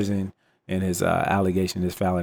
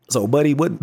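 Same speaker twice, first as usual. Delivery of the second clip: ordinary delivery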